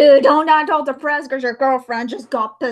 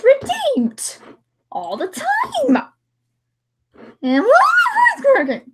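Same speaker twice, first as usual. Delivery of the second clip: high, drawn-out complaint; mock outraged whine; exasperated whine; 13 semitones higher, 3 dB louder, much slower than usual. Real, not acted. Complaining about villains redeemed.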